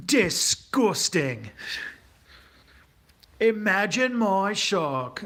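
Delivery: condescending northern British accent, hammed-up; Paul Joseph Watson. Streamer mimics a British YouTuber leaning into camera, slow condescension. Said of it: "very condescending. He's got that, like, that northern British accent".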